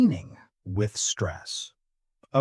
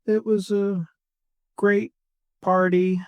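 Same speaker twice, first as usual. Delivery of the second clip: sarcastic; 5 semitones higher than usual. Retold or imitated. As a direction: drag it out word by word, no enthusiasm at all. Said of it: "He was being sarcastic".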